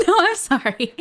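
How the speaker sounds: giggling through an apology; laughing. Real, not acted. Laughing at the voice effect.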